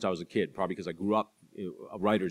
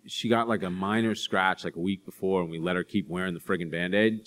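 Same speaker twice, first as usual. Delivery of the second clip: normal talking voice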